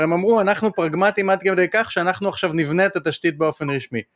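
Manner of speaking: normal talking voice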